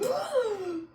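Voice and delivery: high pretend gasp of wonder; imitated child gasping in awe; kid's awed gasp. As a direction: gasp like a child discovering magic. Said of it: "the kids be like".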